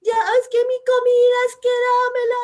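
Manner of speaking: put-on very high, loud child's tantrum scream; imitating a screaming little daughter. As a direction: shriek it like a little girl demanding food. Child's tantrum over food.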